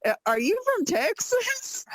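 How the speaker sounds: a New York lady surprised by y'all. Retold or imitated. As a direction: play the lady caught off guard. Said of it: "And the lady said".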